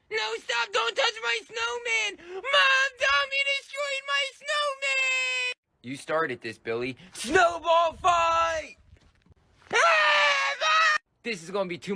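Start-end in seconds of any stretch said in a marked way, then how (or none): 0.1-5.5 put-on high, slow wailing boy; whiny tearful kid. Kid crying to mom. 9.7-11.0 put-on piercing kid scream; high shriek. Snowball fight.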